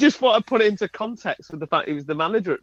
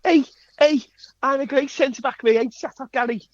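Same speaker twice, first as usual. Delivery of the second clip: Jamie Carragher impression; 8 semitones higher than usual.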